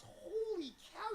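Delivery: very high and slow, stretched in wonder; amazed exclamation. Retold or imitated. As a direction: gape at how much he's changed. Old bully recognizing him.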